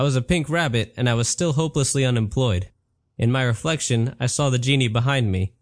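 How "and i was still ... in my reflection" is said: ordinary delivery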